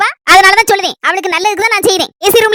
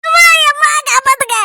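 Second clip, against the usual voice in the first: crying for help; 7 semitones higher than usual. Acted. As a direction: sob and shout for someone to save you. Still begging for rescue.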